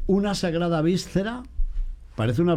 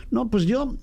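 higher, prissy imitation of a fussy parishioner; mock devotee; 6 semitones higher than usual. Priest mimicking a choosy devotee, mock prissy. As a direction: lightly play the picky believer.

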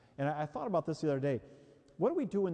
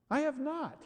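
high, sharp protest; indignant denial; 12 semitones higher than usual. Retold or imitated. Husband protesting to his wife, indignant high denial. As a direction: snap back offended.

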